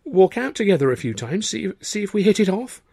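high, halting nervous suggestion; stammering. A narrator's reading. Arthur to Tilly suggesting courtship, stammering high. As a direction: stumble through it. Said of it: "I stammered".

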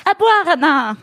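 réplique lancée plus fort; beuglé. Acted. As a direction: beugle l'ordre à la fête. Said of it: "Bugle Kate". (Kate beugle à boire)

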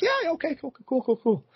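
high chirpy cool cool cool; high fake enthusiasm. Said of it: "So I'm like".

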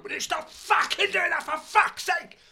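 hammed-up furious cinemagoer losing it; furious outburst. Man in the cinema snaps at the girl behind, hammed-up furious yell. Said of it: "He literally just went".

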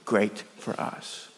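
dropping to a whisper; soft, whispered close to a lament; hushed awe. Acted. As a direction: let the last words fade away. End of madman's lament.

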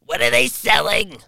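silly put-on voice. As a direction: pop it out in a funny voice. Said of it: "now I'm just doing voices".

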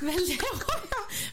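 high, laughing panic; panicked. Retold or imitated. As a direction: squeal it out in a fluster. Panicked retelling of own thoughts.

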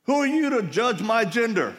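high, loud indignant yell; hammed-up outraged shout; mock yell. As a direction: play the offended young man shouting. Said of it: "he yells".

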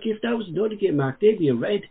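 ordinary talk, nothing marked

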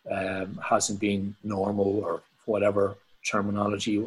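usual speaking voice

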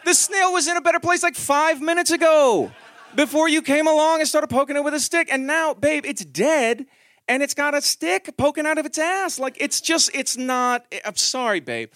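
high, loud, laughing exasperation; exasperated rant. Retold or imitated. Dad exasperated at daughter over the poked snail, high and laughing. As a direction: rant at her, half laughing.

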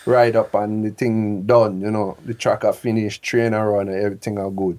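a hint of put-on patois; mock Jamaican-style slang. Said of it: "I'm not going like".

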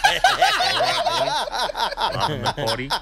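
squealing laughter through the words; cracking up while signing off; high laughing sign-off; laughing hard. Real, not acted.

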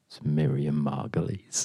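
hushed dramatic whisper. Acted. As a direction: breathe the name like a secret. Dramatic reveal of duelist.

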